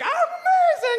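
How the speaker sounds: put-on shouted, very high baby boast; exultant toddler. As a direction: squeal it loud as a proud toddler. Toddler proud of first step.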